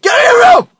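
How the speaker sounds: put-on screaming at a kid to go to their room; angry parent shout. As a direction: yell it high and loud like a furious parent. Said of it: "when your parents piss you off".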